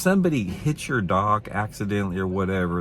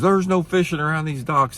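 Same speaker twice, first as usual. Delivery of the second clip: much higher, mock-indignant retelling of the loudmouth; mock angry loudmouth; 10 semitones higher, 4 dB louder than usual. Loudmouth insisting to the angler, mock indignant and high. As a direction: squeal his outrage like the pushy guy. Loudmouth guy repeats his warning.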